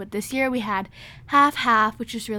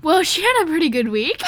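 playful excitement; 7 semitones higher, 10 dB louder than usual. Real, not acted. Mock wrap-up, big laugh.

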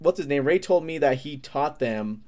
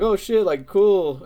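higher and louder, impressed; excited; 8 semitones higher, 6 dB louder, much slower than usual. Retold or imitated. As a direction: gush over it. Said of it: "and I'm like".

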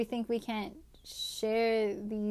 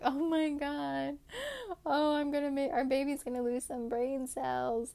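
higher-pitched, drawn-out joking alarm; mock dismay; 4 semitones higher than usual. Mom joking about baby's brain cells, mock dismay. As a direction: wail it lightly as a joke worry. Mock panic over baby, then "just kidding.

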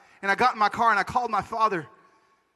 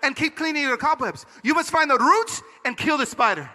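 put-on emphatic, higher Panamanian accent; Panamanian father; 5 semitones higher than usual. Dad urging him to find the root, emphatic accent. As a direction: drive the advice home with a Panamanian accent. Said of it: "He said".

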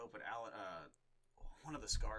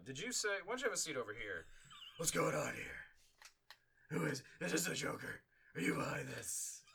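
higher, brisk interrogator voice; Chris Hansen impression; 6 semitones higher, 4 dB louder than usual. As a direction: grill him like a TV host. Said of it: "Chris Hansen goes out".

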